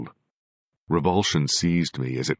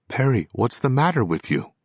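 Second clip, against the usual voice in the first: high, louder demand; 9 semitones higher, 4 dB louder than usual. A narrator's reading. A ghost demanding an answer from the medium, raised voice. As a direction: press him hard for an answer. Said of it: "I demanded".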